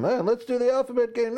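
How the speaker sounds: imitating his son begging for a car game; child's voice. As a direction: chirp it like a little kid asking. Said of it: "they want to play the alphabet game".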